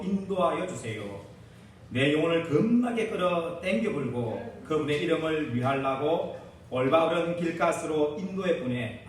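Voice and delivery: put-on hammy Korean country-dialect recital, hopping from one province's accent to the next; medley of Korean regional dialects. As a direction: pastor playfully reads the psalm in mixed regional dialects. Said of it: "우리 사투를 가지고 제가 한번 읊어볼 테니까".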